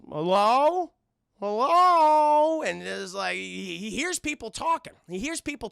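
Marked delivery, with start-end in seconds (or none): 0.1-0.9 loud, raised questioning call. Retold or imitated. Man knocking on the castle door, calling out. 1.4-2.6 very loud, high, drawn-out call; bigger calling out. Retold or imitated. Man at the castle door, hollering out.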